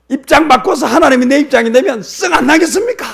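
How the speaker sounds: very high, loud challenge; indignant retort. Acted. Jonah challenges God to take his place, indignant. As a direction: argue back at full pitch, outraged. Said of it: "이렇게 이야기하면서 화를 내니까".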